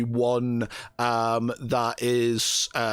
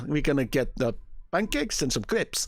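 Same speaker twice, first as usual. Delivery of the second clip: ordinary talk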